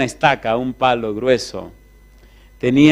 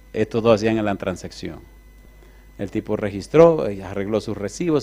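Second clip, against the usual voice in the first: usual speaking voice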